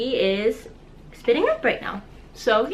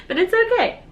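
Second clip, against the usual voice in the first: real tears, voice pushed high; fighting back tears while reassuring; crying; 11 semitones higher, 4 dB louder, faster than usual. Real, not acted. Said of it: "I'm crying".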